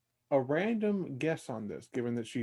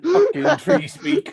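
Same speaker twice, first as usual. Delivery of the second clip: laughing; 12 semitones higher, 10 dB louder than usual. Real, not acted. Player joining the dwarf joke.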